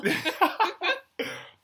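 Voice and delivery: high, loud burst of laughter; real high-pitched cackling laugh. Real, not acted. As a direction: crack up loudly at a joke. Laughs at podcast call-out.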